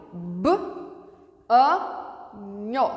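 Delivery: "b, a, nho" in three pieces with long pauses; sound by sound. Shown as a demonstration. Teacher breaking banho into its sounds, segmented. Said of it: "Na palavra banho, por exemplo".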